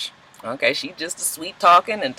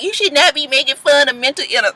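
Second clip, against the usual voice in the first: mock scolding; 10 semitones higher than usual. Imagined scolding viewer.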